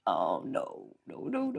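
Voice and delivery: a quiet, mock-dismayed refusal of hand signals. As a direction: sink into dread. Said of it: "And I was like".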